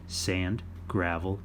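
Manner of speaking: ordinary delivery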